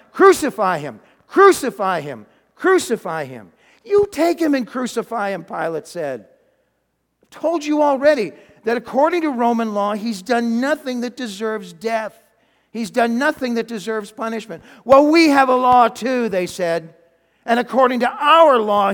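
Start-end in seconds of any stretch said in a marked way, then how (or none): 0.1-3.5 loud, punched demand; crowd shouting. Acted. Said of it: "they began to shout". 3.8-5.4 high, loud retort; exasperated retort. Acted. Pilate retorts to the mob. 7.2-14.6 raised, emphatic voice; insistent protest. Acted. Pilate argues with the crowd. 14.8-16.3 loud, high comeback; heated retort. Acted. Leaders argue back at Pilate.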